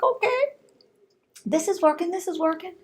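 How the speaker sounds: pleased self-quote. Retold or imitated. Quoting her pleased self.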